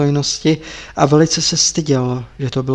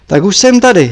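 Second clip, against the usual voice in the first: brusque announcement; 4 semitones higher, 6 dB louder than usual. Acted. The master arrives, brusque.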